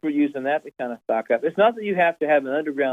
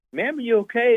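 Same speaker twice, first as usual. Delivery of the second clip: higher-pitched concerned question; concerned store employee; 7 semitones higher than usual. Retold or imitated. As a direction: retell a worker gently checking on an upset shopper. Employee checks on upset shopper.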